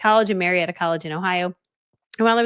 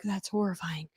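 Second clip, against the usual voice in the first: breathless, hushed whisper of dread; mock-horrified whisper to herself; 10 dB quieter, slower than usual. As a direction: whisper it like the idea scares you. Dreading meeting strangers at mall.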